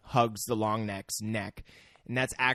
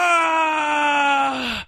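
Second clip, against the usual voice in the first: long, loud, high cry of being shot; fake-die with a drawn-out yell; mock death scream; 6 dB louder than usual. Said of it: "I get shot".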